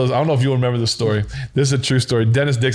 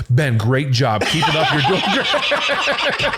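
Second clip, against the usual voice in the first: imitated coach's praise breaking into laughter; mock encouragement; 3 semitones higher, much slower than usual. Coach covering up to his quarterback over the headset, mock encouraging. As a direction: switch to cheery praise, laughing by the end. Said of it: "didn't know he hit the button".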